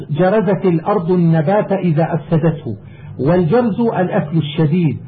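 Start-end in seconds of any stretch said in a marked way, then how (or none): none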